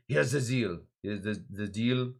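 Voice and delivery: lightly attempted German accent. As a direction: try a half-hearted German accent. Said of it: "probably say it in a German accent".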